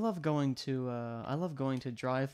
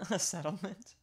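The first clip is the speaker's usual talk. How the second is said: laughing echo; 5 semitones higher than usual. Retold or imitated.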